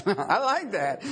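chuckling through the words; laughing. Real, not acted. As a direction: laugh at your own joke. Laughs at own joke.

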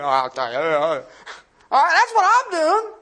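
slow, half-whimpering resignation; mock bewildered whine; dazed disciple. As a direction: drag it out, stunned. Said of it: "sitting there going".

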